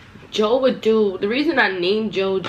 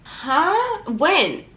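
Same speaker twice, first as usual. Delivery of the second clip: high-pitched questioning disbelief; mock bafflement at an outrageous claim; incredulous; 9 semitones higher than usual. Incredulous reply to boyfriend's false claim.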